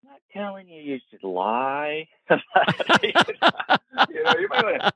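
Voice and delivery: high, sly half-joking aside, laughing into it; a high teasing nudge to lie; sly hint. Retold or imitated. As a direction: wink at her while you say it. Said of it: "I'm saying".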